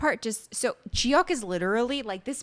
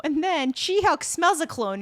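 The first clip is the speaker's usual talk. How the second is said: normal talking voice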